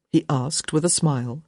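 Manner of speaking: plain reading voice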